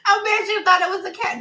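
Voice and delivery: fast, high teasing line full of laughter; real laughter; laughing. Real, not acted. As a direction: rush the tease while cracking up.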